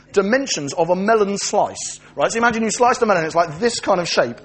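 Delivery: ordinary talk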